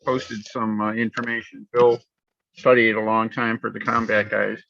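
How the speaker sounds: tinny, metallic phone line; tin can sound. Real, not acted. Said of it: "Sounds like you're in a tin can".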